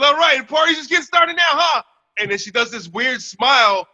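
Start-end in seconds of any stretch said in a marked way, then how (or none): 0.0-1.8 put-on older lady's voice. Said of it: "She goes".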